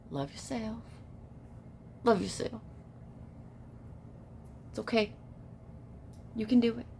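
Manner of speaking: soft, hushed reassurance; very quiet, soothing affirmation; gentle encouragement. Real, not acted. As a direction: murmur it tenderly with long pauses. Gently encourages listener in hush.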